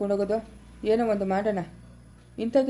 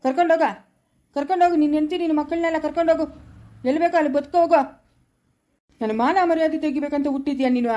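furious shout; 3 semitones higher, faster than usual. Acted. Father yelling at son to take his family and go. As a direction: drive him out of the house with your voice.

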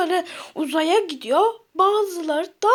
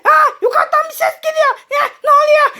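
startled panic; 6 semitones higher, 5 dB louder, faster than usual. Acted. Scared by voice from above.

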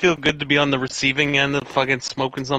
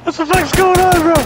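loud disbelief; 12 semitones higher, 8 dB louder than usual. Real, not acted. Chaos after getting stuck.

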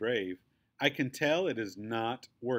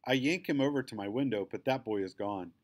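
plain reading voice

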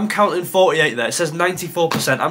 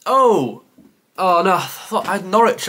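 higher, louder cry of dismay; dismayed realisation; 4 semitones higher, 4 dB louder than usual. Real, not acted. Quiz player realising his mistake, dismayed. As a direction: let out a real "oh no" of dismay. Realises a guess was wasted.

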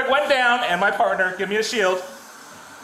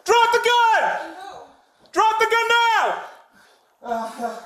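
slow drawn-out police yell, higher; shouting commands; 8 semitones higher, much slower than usual. Real, not acted. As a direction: stretch each word in a hard shout. Police shouting at armed suspect.

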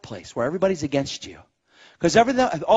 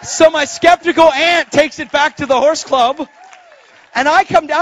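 preaching at full force; 9 semitones higher, 10 dB louder than usual. Real, not acted. Preacher tells congregation of skeptical aunt at horse club, loud and high. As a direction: belt it out to the congregation, pitched up.

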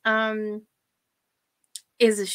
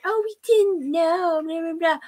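high, whiny mock excuse; mocking her; 7 semitones higher, 3 dB louder than usual. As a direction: sneer out her excuse in a higher voice. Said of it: "She says later".

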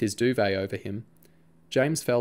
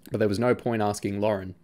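usual speaking voice

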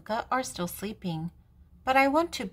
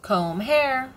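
mama voice; 5 semitones higher, 5 dB louder than usual. Acted.

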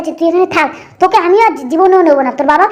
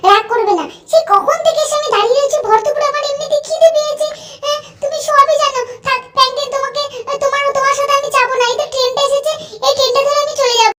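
put-on high, softer pouting girlfriend voice; sulky rich girl; 8 semitones higher, 4 dB quieter than usual. Angel Priya complaining to Franklin about waiting in the sun, high and sulky. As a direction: whine like a spoiled rich daughter.

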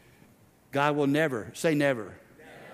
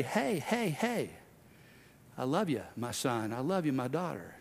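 tender Jesus voice; 3 semitones lower, 8 dB quieter than usual. Acted. Jesus tenderly lifting up believers.

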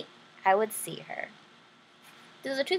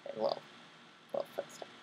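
whisper; 17 dB quieter than usual. Real, not acted. Quiet aside to the listener.

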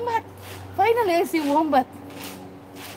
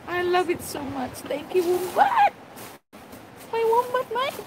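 much higher, breathless squeals of joy; overjoyed squeal; 6 semitones higher, 3 dB quieter than usual. Real, not acted. Finally seeing the wombat, squealing with joy. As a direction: squeal like a dream came true.

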